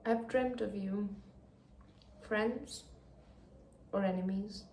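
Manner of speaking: soft, hushed and moved; hushed reading. Real, not acted. Reader quietly reading a tender reunion line. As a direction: read it barely above a breath.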